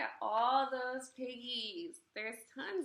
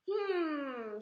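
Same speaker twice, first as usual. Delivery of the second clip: witch hmm; 5 semitones higher, 6 dB louder than usual. Acted. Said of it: "she said".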